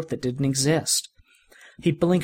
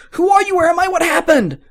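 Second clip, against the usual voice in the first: much higher, loud frantic cries; panicked questions; 8 dB louder than usual. Acted. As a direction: shout in terror, lost and confused. Billy panicking on waking.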